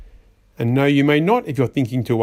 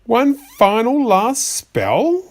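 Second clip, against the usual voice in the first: incredulous; 7 semitones higher, much slower than usual. Acted. Disbelief at another spell.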